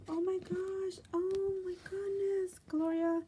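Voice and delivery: high, drawn-out, amazed exclamation; overwhelmed delight. Real, not acted. Vlogger thanks Gloria for the paper, high and overwhelmed. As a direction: stretch it out high in real wonder.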